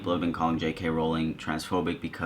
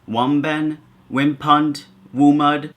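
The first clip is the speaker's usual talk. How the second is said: higher, louder, sounding out each garbled word; mock word-groping; 8 semitones higher, 9 dB louder, much slower than usual. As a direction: read the made-up words out in mock puzzlement. Reading the mocking tweet aloud.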